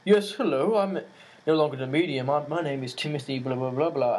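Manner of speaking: mock English-accented ghost voice; put-on English accent. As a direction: act a ghost introducing himself in an English accent. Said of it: "this English accent and be like".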